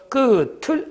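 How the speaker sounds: teacher showing students how 끝을 links, said 끄틀; linked pronunciation. Shown as a demonstration. Teacher answers the class's pronunciation question, demonstrating linked 끄틀. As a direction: say 끝을 as 끄틀, final consonant carried over. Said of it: "거기 바로 뭐가 됩니까".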